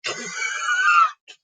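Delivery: a loud, high, gloating laugh; shrieking mock laughter; mocking cackle. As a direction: laugh at the rich boy's misfortune. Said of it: "Joey's like".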